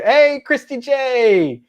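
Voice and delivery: high, drawn-out comic greeting; funny accent greeting. Acted. Host greets arriving listener in high funny voice. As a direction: sing out a silly accented hello.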